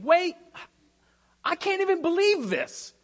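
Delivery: high, loud disbelief; can't believe what he's hearing; incredulous. Retold or imitated. Paul scolding the church, high loud incredulous outburst. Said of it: "Paul is having this attitude".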